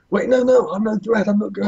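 very high, panicked plea; acted squeal of a pinned man protesting; pleading surrender. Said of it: "He's like".

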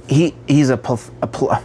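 "pleth-" broken off, then "pl-" cut even shorter; real stumble, stuck on "pleth-"; tongue-tied stumble. Real, not acted. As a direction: trip over the start of "pleth-" and restart. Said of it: "I can't talk".

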